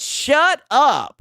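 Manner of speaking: hammed-up wife telling him off; imitating wife. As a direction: snap it like the annoyed wife. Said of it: "She said".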